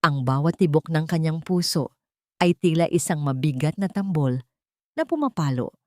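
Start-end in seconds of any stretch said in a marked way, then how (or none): none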